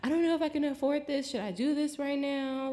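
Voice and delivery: high, fast worried rush; panicking over the money; anxious. Retold or imitated. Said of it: "I was like".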